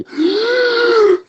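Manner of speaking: high, loud desperate gasp; gasp for air. Retold or imitated. Imitating movie surfers coming up between waves, gasping.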